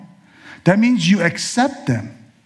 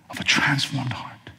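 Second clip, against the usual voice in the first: hushed, unvoiced repeat of the phrase; whispered echo; 5 semitones lower, 9 dB quieter than usual. Real, not acted. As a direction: breathe the words back softly.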